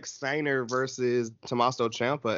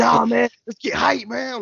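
higher, louder playful urging; acted hyped-up friend; 9 semitones higher, 3 dB louder, slower than usual. The one friend urging everybody to get hyped, mock excitement. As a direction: lightly play the friend pumping everyone up. Voicing the friend who wants hype.

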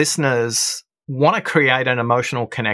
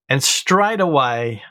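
usual speaking voice

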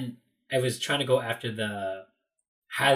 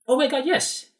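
high, loud excited yes; delighted recognition; 14 semitones higher, 5 dB louder than usual. Retold or imitated. Tour guide finally recognises the donut request, delighted. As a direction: burst out with eager confirmation.

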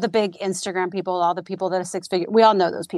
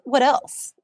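normal talking voice

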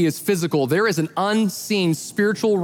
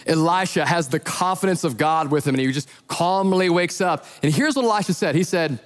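usual speaking voice

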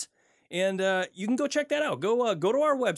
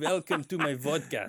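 put-on playful Russian accent; hammy Russian host voice. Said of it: "say it in a Russian accent".

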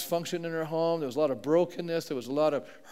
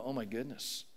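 softer, a breathed-out realization; hushed dismay; 6 semitones lower, 13 dB quieter than usual. Retold or imitated. Believers discovering another layer of pain, hushed dismay. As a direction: let it fall away quietly, caught off guard.

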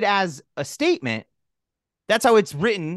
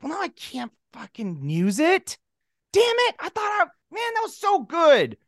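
mock frustration; 9 semitones higher than usual. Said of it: "he's like".

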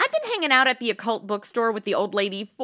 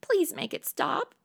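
higher, softer, slow plea; pleading; 7 semitones higher, 5 dB quieter than usual. Acted. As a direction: beg weakly to be released. Begs to quit the job.

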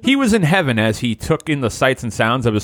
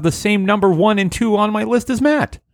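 whiny high voice; 7 semitones higher than usual. Acted. Narrator voices whining Matt.

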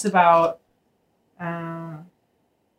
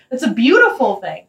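usual speaking voice

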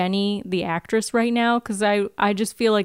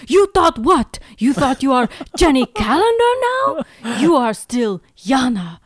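put-on higher, louder accented scorn with a laugh; a hammed-up villain sneering You thought what; Russian accent; 3 semitones higher, 5 dB louder than usual. The villain scorns Jenny's new name, a hammed-up Russian accent. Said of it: "hear me try to do an accent".

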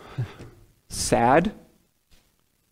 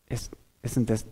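knowing murmur; 4 semitones lower, 9 dB quieter than usual. Retold or imitated. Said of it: "you're like".

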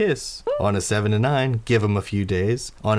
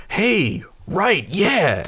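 high-pitched, eager call of recognition; excited greeting; 10 semitones higher, much slower than usual. Acted. Doggy Daddy notices Warble, an excited greeting. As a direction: call out brightly to someone just noticed.

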